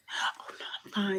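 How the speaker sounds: breathy little whisper, brushing it off; coy whisper. Retold or imitated. To her date, playing modest, in a coy whisper. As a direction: hush it, play modest.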